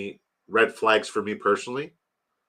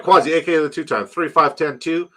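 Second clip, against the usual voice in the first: ordinary delivery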